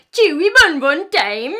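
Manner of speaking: loud, playful mock-foreign shout; mock dinosaur yell. Storyteller to kids, a playful mock Norwegian yell. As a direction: shout it like silly gibberish.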